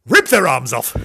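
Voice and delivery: loud, sudden comic outburst; mock savage threat. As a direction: blast out the gruesome joke. Joke threat from the puppy.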